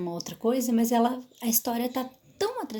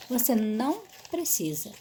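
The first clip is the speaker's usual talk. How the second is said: usual speaking voice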